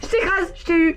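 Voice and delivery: high, loud gotcha; proud mock victory cry; triumphant shout. Jeu avec l'araignée, triomphe. (cri de victoire)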